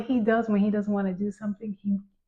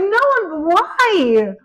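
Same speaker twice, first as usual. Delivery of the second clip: incredulous; 10 semitones higher, 6 dB louder, much slower than usual. Retold or imitated. Mother's incredulous reply.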